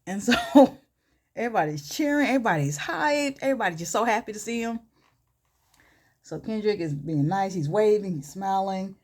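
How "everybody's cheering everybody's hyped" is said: ordinary delivery, nothing marked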